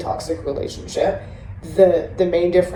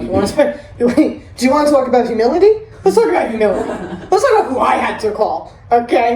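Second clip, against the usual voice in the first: laughing mock challenge; 5 semitones higher, 5 dB louder, faster than usual. Mocking quote to a relapser.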